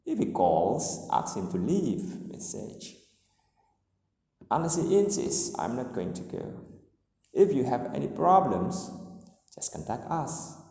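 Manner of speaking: first clause lifts, second clause sinks gradually; rise-fall conditionals. Shown as a demonstration. Teacher models conditional sentences, rising then falling. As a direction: climb through "If you have any problems", fall away on "just contact us". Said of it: "the tune rises in the first clause".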